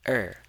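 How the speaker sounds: er falling from high; fourth tone. Shown as a demonstration. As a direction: drop "er" sharply downward. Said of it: "this has a fourth tone".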